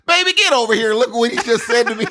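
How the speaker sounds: high hammed-up holler to the wife; indignant Texan husband. Texan calling his wife over, comic indignation. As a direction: call her over in mock outrage.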